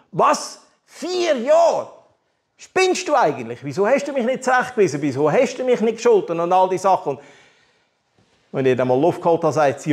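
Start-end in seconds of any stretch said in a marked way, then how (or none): 0.1-7.2 high, fast, indignant yelling at mother; mock outraged outburst. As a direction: hurl the accusations, higher and faster. Retold outburst at his mother. (empörter, höherer und schnellerer Ausbruch gegenüber der Mutter)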